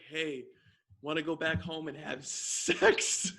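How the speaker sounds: slow, low mock come-on; awkwardly deliberate imitation of a seduction; stilted video actor. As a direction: imitate a badly acted pickup line, drawn out. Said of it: "who says that?".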